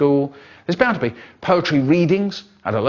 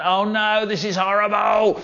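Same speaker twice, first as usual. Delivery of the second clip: put-on higher, whiny child's disgust; mock disgust; 7 semitones higher than usual.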